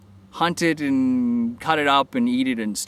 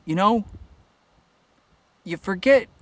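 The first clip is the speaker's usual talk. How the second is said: usual speaking voice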